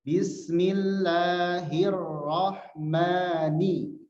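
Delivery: "Bismillahi" very slowly, each sound held; slow recited. Shown as a demonstration. Teacher leading students in slow recitation. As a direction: recite "Bismillahi" for the class to repeat. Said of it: "kita latih bismillahnya".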